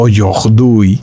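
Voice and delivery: aujourd'hui slowed, with a guttural French r; French pronunciation. Shown as a demonstration. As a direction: give aujourd'hui its throaty French r. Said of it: "que son guturales". (aujourd'hui con r gutural francesa)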